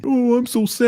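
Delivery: high-pitched mock whining; mock sad. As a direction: whine it like a self-pitying friend. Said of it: "speak like that around me".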